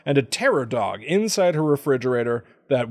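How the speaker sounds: ordinary delivery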